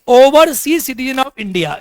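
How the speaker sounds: very slow, every word spaced out; slow dictation. Shown as a demonstration. Teacher dictating the full form to students, very slowly.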